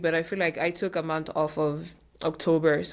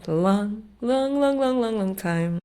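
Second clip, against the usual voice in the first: sing-song, higher and slow on the repeated long; drawn-out long; 5 semitones higher, 4 dB louder, slower than usual. Real, not acted. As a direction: stretch each long in a rising, then falling wave. Stretching out how long it has been.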